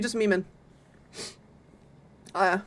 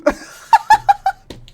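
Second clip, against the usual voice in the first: high, loud laughing fit; embarrassed laughter; 7 dB louder than usual. Real, not acted. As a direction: burst out laughing at your own mistake. Laughs at own sticky note mistake.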